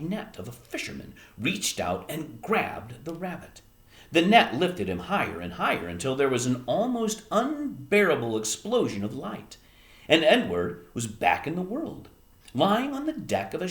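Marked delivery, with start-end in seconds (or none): none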